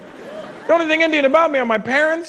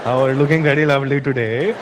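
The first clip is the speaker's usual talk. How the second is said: much lower, crooning pickup lines; smooth flirt; 9 semitones lower than usual. Retold or imitated. Comic voicing men hitting on women, low crooning flirt. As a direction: lay on the low charm.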